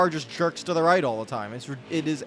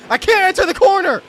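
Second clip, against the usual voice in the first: much higher, much louder furious yell; angry shout; 11 dB louder than usual. Real, not acted. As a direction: scream it at the game in real rage. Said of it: "this is making me very angry".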